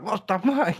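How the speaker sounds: high, laughing disbelief; shocked laughing. Retold or imitated. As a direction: blurt it out through a laugh. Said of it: "I'm just like".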